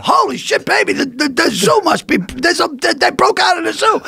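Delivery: high, excited mock panic; frantic retelling; retold panic. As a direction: gasp it out like you saw a wild animal. Retold panic about animals loose.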